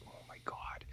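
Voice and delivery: barely audible mock dismay; hushed, disbelieving aside; exasperated whisper. Mock exasperated reaction to character.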